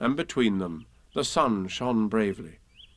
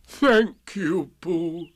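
higher, mournful donkey voice; Eeyore, doleful thanks; 5 semitones higher, much slower than usual. A narrator's reading. Donkey thanking his friend, doleful and higher. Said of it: "answered Eeyore".

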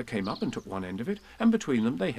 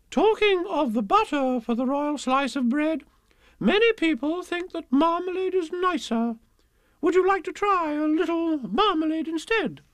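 coaxing queen voice; 5 dB louder than usual. Acted. Queen coaxes the King.

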